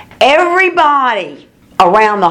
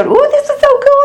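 much higher, mock-excited girlfriends; mock gushing girls. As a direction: imitate the thrilled girls, hammed up. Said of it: "they all came to go".

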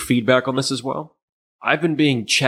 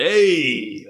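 raised, drawn-out Brooklyn call; Brooklyn accent; 4 semitones higher than usual. Shown as a demonstration. Podcaster trying out the defendant's voice, Brooklyn accent. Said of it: "why he has a Brooklyn accent".

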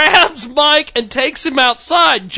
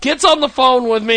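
ordinary delivery

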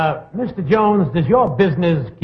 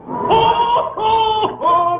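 jolly ho ho ho; much slower than usual. Acted. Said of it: "could I hear the guest say something".

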